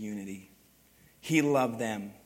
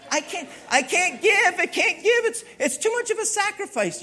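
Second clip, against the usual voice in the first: whiny reluctant giver; 13 semitones higher than usual. Pretend. Pastor mocking people who won't give, high whiny voice. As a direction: whimper like someone who can't part with money.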